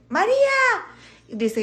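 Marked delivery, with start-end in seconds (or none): 0.1-0.4 joke-telling husband calling out to his wife; mock calling shout. Husband calling his deaf wife from the living room, mock calling shout. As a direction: call her name from another room, rising. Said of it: "A ver, grítale".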